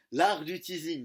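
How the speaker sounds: en riant. Real, not acted. Rit de la taquinerie.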